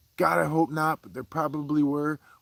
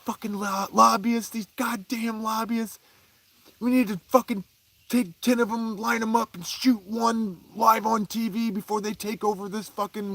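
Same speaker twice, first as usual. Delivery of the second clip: higher-pitched mock rant at the TV; imitating dad's rant; 6 semitones higher than usual. Dad yelling at the TV about lobbyists, higher mock rant. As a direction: play the furious dad yelling at the news. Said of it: "would scream at the T V".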